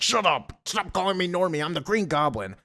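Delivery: high-pitched tantrum voice; mock angry outburst. Villain rejecting his childhood nickname, high mock-angry outburst. Villain snaps back in retold quarrel.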